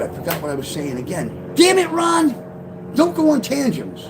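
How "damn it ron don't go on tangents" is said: self-scolding. Real, not acted. Scolding himself for tangents.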